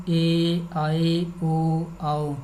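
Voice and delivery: ordinary delivery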